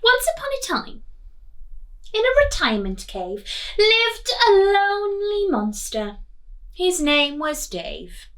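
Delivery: lively read-aloud storytelling voice; story reading. A narrator's reading. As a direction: read it like a picture book to children. Teacher reading picture book aloud.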